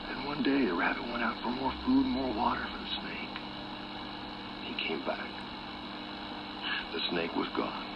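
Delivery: very soft, hushed narration; hushed fable narration; suspenseful storytelling. A narrator's reading. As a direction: drop the volume for ominous suspense, leaving pauses.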